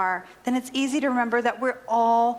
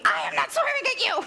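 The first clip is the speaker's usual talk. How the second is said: high, fast imitation of an irate customer; hammed-up screamed denial; mock scream; 11 semitones higher, faster than usual. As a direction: shriek it in comic outrage, without much volume. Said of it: "And she screamed at me".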